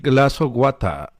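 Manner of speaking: light mock British accent; a lightly voiced posh English request; imitating British accent. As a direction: imitando acento británico. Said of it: "Aquí dicen".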